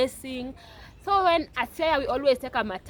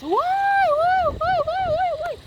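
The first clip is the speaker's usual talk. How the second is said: high, loud anguished screaming; screaming why; 6 dB louder, slower than usual. Retold or imitated. Girl in the matatu realizing her mother died, screaming. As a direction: wail it out like grief has just hit. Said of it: "I just started screaming in the matatu".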